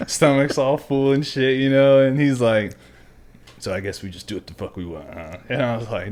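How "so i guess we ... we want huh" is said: fast, low mock instructor; imitated quiet menace. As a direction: mutter it low at the guilty recruit. Instructor confronting recruit, retold.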